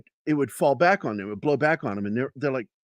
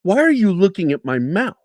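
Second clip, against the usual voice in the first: higher, louder defensive challenge; acted-out uptight retort; retold indignant outburst; 8 semitones higher, 6 dB louder than usual. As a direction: demand it, touchy and accusing. Paranoid outburst in retold quarrel.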